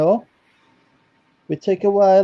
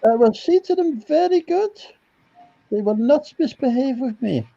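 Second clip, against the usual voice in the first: prim nanny in a high voice; Mrs Doubtfire voice; 11 semitones higher than usual. Pretend. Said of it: "it's Missus Doubtfire here".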